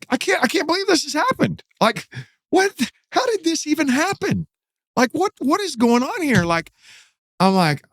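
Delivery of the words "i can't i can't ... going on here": very high-pitched mock bewilderment; retold panicked disbelief. Storyteller relives facing murder in prison, high-pitched disbelief. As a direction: squeak out helpless disbelief, far above your usual voice.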